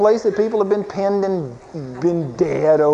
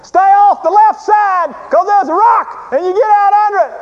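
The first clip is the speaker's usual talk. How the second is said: high, loud shouted orders; imitated yelling; 7 dB louder than usual. Guide warning rafters through the roar, imitated yelling. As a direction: yell it over the roar like a river guide. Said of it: "this guide starts yelling at us".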